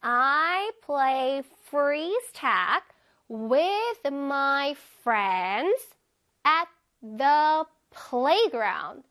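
word by word, drawn out for learners to follow; very slow reading. Shown as a demonstration. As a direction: read it very slowly, stretching each word. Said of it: "천천히". (아주 천천히 또박또박 읽기)

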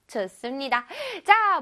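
ordinary talk, nothing marked

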